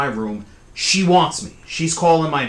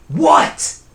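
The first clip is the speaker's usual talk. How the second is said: very high, loud yelled question; exasperated shout; 8 dB louder than usual. Retold or imitated. Annoyed sleeper answering the cousin's repeated calls, exasperated shout. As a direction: explode at being called once more. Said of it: "she yells my name again. And I'm like".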